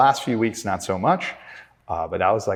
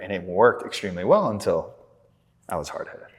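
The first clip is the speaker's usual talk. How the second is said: normal talking voice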